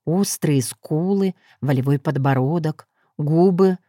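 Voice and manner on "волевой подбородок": ordinary delivery, nothing marked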